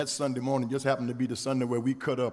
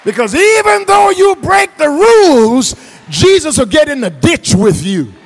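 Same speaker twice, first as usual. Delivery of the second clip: loud, high, driving pulpit delivery; impassioned preacher shouting to the congregation; full-force preaching; 14 semitones higher, 17 dB louder than usual. Real, not acted. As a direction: build the list and hammer each phrase home.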